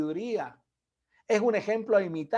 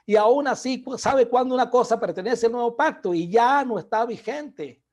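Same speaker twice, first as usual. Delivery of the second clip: louder, driving preacher's cadence, pressing each point; preaching at full force; 3 semitones higher, 6 dB louder than usual. Acted. Preacher urging his listeners to study the Bible, in a loud driving voice. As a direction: hammer the point home to the congregation.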